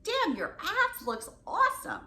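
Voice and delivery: high, loud and slow, savoring it; an appreciative, drawn-out catcall; lusty compliment. Shown as a demonstration. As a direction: relish every word of it.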